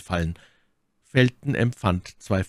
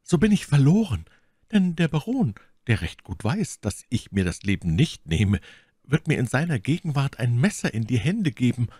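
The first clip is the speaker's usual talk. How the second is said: scheming Milady; 6 semitones higher than usual. Acted. Milady alone fears her act will be exposed, anxiously calculating. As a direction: think the danger through aloud.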